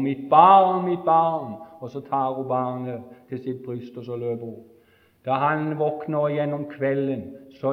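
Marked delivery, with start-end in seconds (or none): 0.0-1.5 anguished cry. Retold or imitated. Mother running to her rescued child, crying out. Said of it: "så sier hun".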